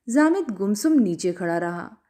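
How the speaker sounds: ordinary delivery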